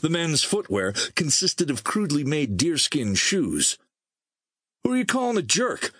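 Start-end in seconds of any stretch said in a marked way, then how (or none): 4.8-5.9 sharp, much higher angry challenge; angry demand. A narrator's reading. Blonde man confronting his companion, angry demand. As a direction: snap it back as an insult. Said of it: "the blonde demanded angrily".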